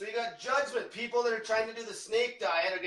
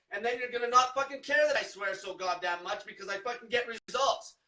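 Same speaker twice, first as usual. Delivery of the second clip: normal talking voice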